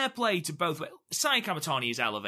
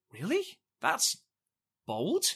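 mock-incredulous, pitched up into a question; retold disbelief; 6 semitones higher than usual. We all reacting to the surprise match pick, retold disbelief. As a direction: voice the doubters with a high rising query.

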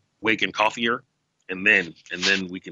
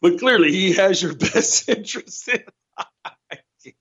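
slow sarcastic line broken by a laugh; real suppressed laughter; laughing through it; 9 semitones higher, slower than usual. Real, not acted. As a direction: fight a laugh through the words. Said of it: "I'm laughing to keep from killing everyone".

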